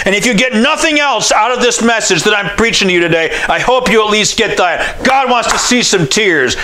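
ordinary talk

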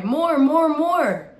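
put-on eager childish cry. A narrator's reading. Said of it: "I cried".

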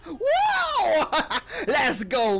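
real burst of laughter into a high whoop; delighted laughing wow; triumphant laugh. Real, not acted. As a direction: laugh out loud then whoop. Laughs after winning fight.

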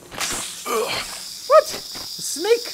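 very high, squeaked question; high-pitched incredulous yelp; startled disbelief. Real, not acted. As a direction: squeak it out in shock. Suddenly bitten by a snake.

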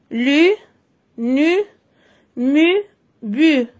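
"lu, nu, mu, bu" each syllable said clearly on its own; teacher drilling consonant plus U syllables. Shown as a demonstration. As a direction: chant the syllables one by one. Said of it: "Je lis les syllabes".

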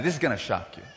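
ordinary delivery

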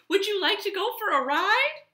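high, loud excited question; eager invitation. A narrator's reading. Cowboy inviting the horse to ride, high and eager. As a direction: ask eagerly, voice lifted high.

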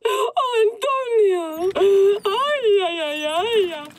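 melodramatic sobbing. Acted. Melodramatic telenovela crying.

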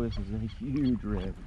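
usual speaking voice

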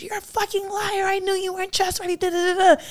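high-pitched accusation tailing into nonsense syllables; overheard argument. Retold or imitated. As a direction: imitate a wife ranting in the background. Imitates distant argument overheard.

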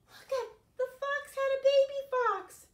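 gasping, high and loud; astonished discovery; surprised. Acted. Storyteller shows kids the baby fox, gasping surprise.

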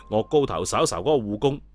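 plain reading voice

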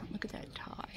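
soft whisper, fading. Real, not acted. Vlogger whispering to viewers about a tie she spots. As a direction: whisper it to the camera.

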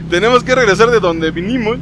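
amused, half laughing. Real, not acted. Ríe y repite más fuerte. (riendo y más fuerte)

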